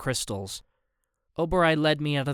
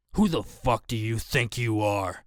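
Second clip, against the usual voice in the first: a slow, lower menacing snap; a lightly voiced big brute snapping a threat; snapped threat; 3 semitones lower than usual. A narrator's reading. The angry player snapping at the narrator, a slow low threat. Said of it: "he snapped".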